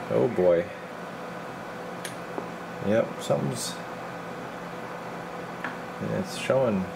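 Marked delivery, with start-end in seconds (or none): none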